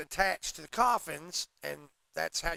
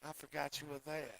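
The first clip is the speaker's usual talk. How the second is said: put-on Doc Holliday's Georgia accent; 12 dB quieter than usual. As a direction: imitate a soft-spoken Georgia gambler, quietly. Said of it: "Talks like he was from Savannah".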